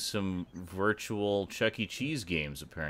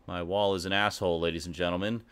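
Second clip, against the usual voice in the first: quick, much softer aside to the audience; muttered aside; 6 semitones lower, 8 dB quieter, faster than usual. Pretend. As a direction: mutter it low and fast.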